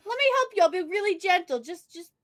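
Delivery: high, loud gentle coaxing; mock tender fuss. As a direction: coo over it gently. Acted tender printer help.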